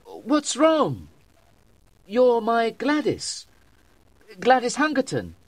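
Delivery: high, distressed appeal; acted high-pitched dismay; crying out. A narrator's reading. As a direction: plead in a high, urgent voice. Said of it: "I cried".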